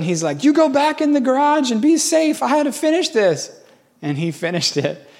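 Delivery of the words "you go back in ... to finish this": high, urgent mock yell; high-pitched acted-out command; retold shouted order. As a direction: imitate a rattled boss calling out orders. Retold boss ordering helper to safety.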